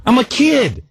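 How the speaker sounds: high-pitched exasperated whine; indignant protest. Retold or imitated. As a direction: plead your case like a wronged kid. Protesting his parents' yelling at him.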